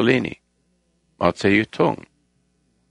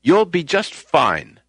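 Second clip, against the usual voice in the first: higher and slow, reassuring; raised, slow, comforting line; 7 semitones higher, much slower than usual. Retold or imitated. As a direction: lift the voice up and slow it right down to soothe. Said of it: "I raise my voice and says".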